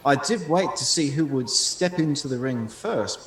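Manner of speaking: ordinary talk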